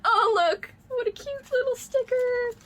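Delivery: very high cooing delight; real gushing joy; excited squeal. Real, not acted. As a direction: coo over something cute. Finding a sticker.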